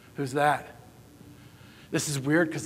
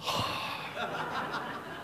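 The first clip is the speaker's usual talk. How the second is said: dreamy blissful sigh; 3 semitones higher, 13 dB quieter than usual. Pretend. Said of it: "and you're just like".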